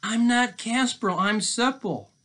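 high-pitched protesting captive; insistent, squeaky storybook boy; Seppel. Acted. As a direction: plead in a high little voice.

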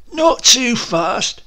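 slow, high, loud interruption; slow him down; second clerk checks the list; second man. Acted.

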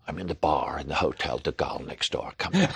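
John Huston impression. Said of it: "That sounds like John Huston".

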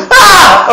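very high, loud scream of fright; shriek. Retold or imitated. Woman at the well sees a demon, very high loud shriek. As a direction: shriek as if you've seen a demon. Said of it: "and goes".

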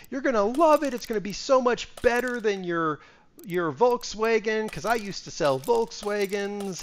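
high, bubbly saleswoman voice, slowing at the end; mock pushy car saleswoman; imitating the saleswoman. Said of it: "the salesperson was all like".

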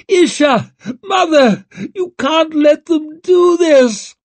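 loud, high wailing plea; desperate screech. A narrator's reading. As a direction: screech for help as you're hauled away. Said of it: "He screeched over his shoulder".

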